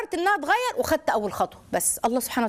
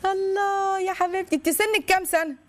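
a high, slow, fond "الله"; slow, high and tender praise; tender delight; 7 semitones higher than usual. Real, not acted. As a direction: melt with affection for the caller. Host moved by caller.

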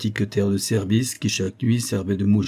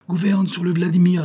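higher-pitched steering command; called-out order; 9 semitones higher, 4 dB louder than usual. A narrator's reading. Elim orders Yorsko toward his ship, calling out. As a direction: call it up to the helmsman.

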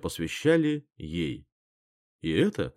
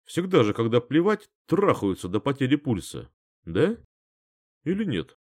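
mocking tease; 3 semitones higher than usual. A narrator's reading. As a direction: needle her with mock innocence. Tom keeps mocking Jenna.

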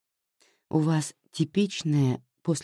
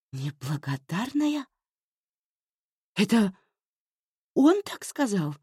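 breathless outrage; 8 semitones higher than usual. Acted. Anya echoes неблагодарная, stunned, outraged and breathless. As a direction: gasp it back, stunned and offended. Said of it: "задохнулась от возмущения Аня".

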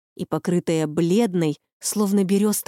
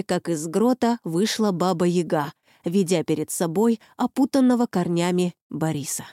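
ordinary delivery

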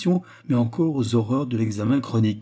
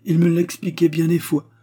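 moved voice; 8 semitones higher, 5 dB louder than usual. A narrator's reading. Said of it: "dit Anna d'une voix émue".

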